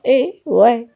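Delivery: high, loud disbelieving cry; incredulous why. Real, not acted. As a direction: call it out in disbelief. Outraged reaction after laughing.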